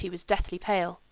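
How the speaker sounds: usual speaking voice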